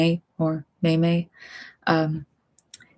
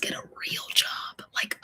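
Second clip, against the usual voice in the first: soft unvoiced whisper; hushed resigned whisper; 11 dB quieter than usual. Real, not acted. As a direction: whisper it like a private thought. Whispering the real job thought.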